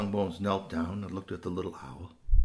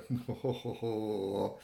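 sly chuckle; 4 dB quieter than usual. Acted. Fox has the owlet in his mouth, sly chuckle. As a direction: chuckle slyly to yourself.

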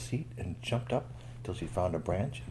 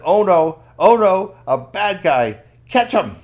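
loud, high alarmed cry; alarmed guards; 10 semitones higher, 15 dB louder, slower than usual. Acted. Palace guards shouting the alarm, loud and high. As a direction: raise the alarm.

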